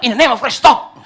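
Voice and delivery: over-serious shout, loudest on the last word; imitated outcry. Overly serious moviegoer interrupting the film, imitated loud outcry. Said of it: "Baru dia bilang".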